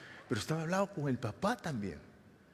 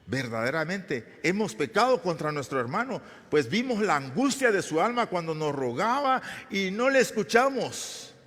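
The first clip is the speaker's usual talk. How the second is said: building from raised to a high, loud anguished cry; anguished confession; 7 semitones higher, 6 dB louder than usual. Acted. Brothers confess to one another, building to an anguished loud cry. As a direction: let the guilt swell until it breaks out.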